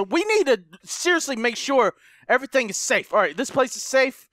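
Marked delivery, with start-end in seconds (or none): none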